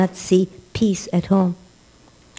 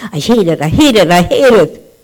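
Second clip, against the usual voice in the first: growing louder hate it; kid's angry outburst; 6 dB louder than usual. Retold or imitated. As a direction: build from grumble to loud outburst.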